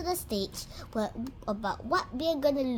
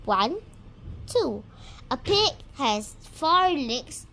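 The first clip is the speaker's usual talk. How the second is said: ordinary talk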